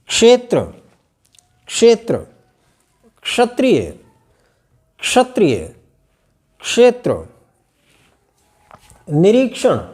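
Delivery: each word set apart, clear kSh; careful क्ष. Shown as a demonstration. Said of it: "इस रूप को ध्यान में रख करके बोलेंगे". (शब्दों का स्पष्ट उच्चारण-अभ्यास)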